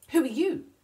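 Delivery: loud, high, sharp snap; snappish. A narrator's reading. Emu to Wombat on scampering by, snapping. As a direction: snap the question out loudly. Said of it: "he snapped".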